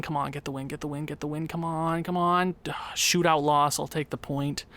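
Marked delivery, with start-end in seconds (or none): 0.0-2.5 hushed urging under the breath; quiet pleading. Real, not acted. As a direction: mutter the plea to win quietly. Quietly willing team to win.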